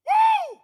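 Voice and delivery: high, loud party whoop. Acted. College student whooping at a party, loud whoop. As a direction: let out a big party whoop.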